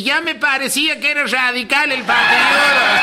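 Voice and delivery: high-pitched holler from the back yard; acted aunt's far-carrying high cry; yelling back. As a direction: shout the answer across the house. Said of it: "le grita del fondo a mi tía".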